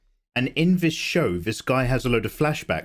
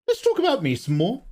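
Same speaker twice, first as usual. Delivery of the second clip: higher, put-on vain voice; hammed-up diva whine; mock self-absorbed; 9 semitones higher than usual. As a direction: play the attention hog for laughs. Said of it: "[name], we don't care".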